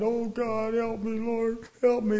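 high, loud pleading wail to God; imitating someone begging heaven for help; mock desperate prayer. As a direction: cry out like a panicked supplicant, hammed up.

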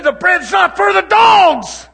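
high, slow shouted rebuke; stern Jesus. Retold or imitated. Preacher voicing Jesus refusing the woman, slow shout. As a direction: boom it out as a harsh verdict. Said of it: "He said".

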